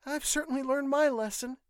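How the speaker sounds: put-on high-pitched young woman, contrite; Judith. A narrator's reading. Judith tells nurse she learned, rueful. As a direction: admit it in a high female register. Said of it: "Judith said".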